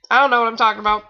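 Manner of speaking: usual speaking voice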